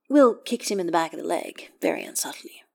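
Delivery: ordinary delivery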